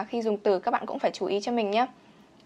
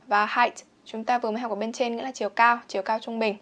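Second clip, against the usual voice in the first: ordinary delivery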